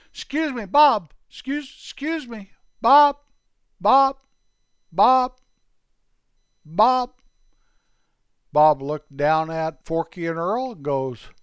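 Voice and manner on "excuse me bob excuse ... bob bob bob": high, loud repeated calls; calling out. Acted. Forky to the busy pharmacist, calling out loudly. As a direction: keep calling to get attention.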